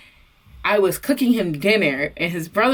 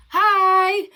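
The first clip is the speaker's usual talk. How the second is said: bubbly hi; 12 semitones higher than usual. Retold or imitated. Bubbly greeting to boyfriend's mom.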